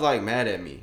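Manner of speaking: usual speaking voice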